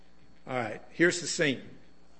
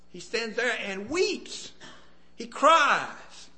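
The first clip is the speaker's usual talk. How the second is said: cries; 8 semitones higher, slower than usual. Acted. Preacher describing Jesus weeping, high anguished emphasis. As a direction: cry out the word with pain.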